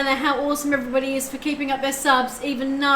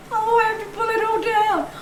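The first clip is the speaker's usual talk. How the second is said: higher, whiny mock lament; mock wail; 8 semitones higher than usual. Streamer retelling cancelled Halloween plans, whiny mock wail. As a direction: play up the Halloween disappointment. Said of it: "I was just like".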